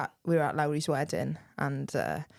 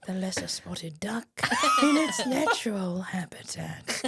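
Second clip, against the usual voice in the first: slow hushed narrator, breaking into laughter; hushed nature-show narration, barely holding a laugh; Attenborough documentary voice; slower than usual. Retold or imitated. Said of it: "that's the impressions too".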